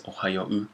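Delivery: ohayo-u with the final u sounded separately; as written. Shown as a demonstration. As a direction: say ohayo-u letter by letter at the end, o then oo. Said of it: "as it's written in Japanese".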